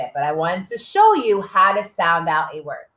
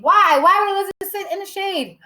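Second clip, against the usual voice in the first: high, exclaimed question; high-pitched, animated "why"; puzzled wonder; 11 semitones higher, 3 dB louder than usual. Shown as a demonstration. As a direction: ask the children in big playful wonder. Quizzes class with mock wonder.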